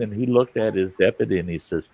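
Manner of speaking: ordinary delivery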